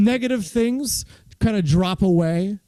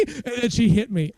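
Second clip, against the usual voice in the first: usual speaking voice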